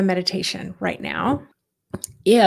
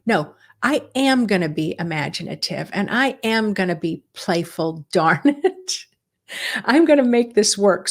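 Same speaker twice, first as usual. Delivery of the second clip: intentional, determined. Acted. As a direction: insist on it with playful determination. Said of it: "that kind of intentional attitude".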